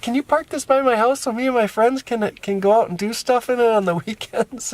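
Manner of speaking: lightly voiced eager child; eager niece. Niece asking to have the van parked by her house, eager.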